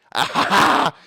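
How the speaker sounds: high, loud fake laugh; hammed-up cackle. Cremator laughing at his own record, loud mock cackle. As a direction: cackle loudly in character.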